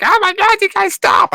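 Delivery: high, loud panicked yell; retold scream. Kids screaming at brothers fighting in camper, high panicked yell. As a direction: shriek at the brothers to stop. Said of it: "Kirk and Zach are screaming".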